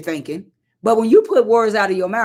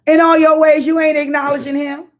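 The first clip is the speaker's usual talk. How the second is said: impassioned high preaching; 9 semitones higher than usual. Real, not acted. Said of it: "rising preaching passion".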